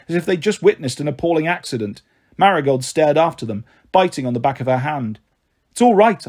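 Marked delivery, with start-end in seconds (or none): 5.7-6.3 quick, high reassurance; hurried and louder, pitched up; said quickly. A narrator's reading. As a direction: jump in hastily to smooth it over. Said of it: "I said quickly".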